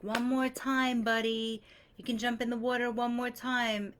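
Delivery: put-on high, sing-song coaxing of a scared kid; retold swim teacher, sweetly pushing a frightened boy; loving coaxing teacher. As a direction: coax him like a gentle swim coach. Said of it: "loving in his voice".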